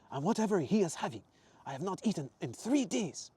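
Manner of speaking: high, emphatic Italian voice; hungry traveller with an Italian accent. Acted. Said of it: "his faded Italian accent".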